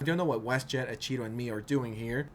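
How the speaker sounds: ordinary talk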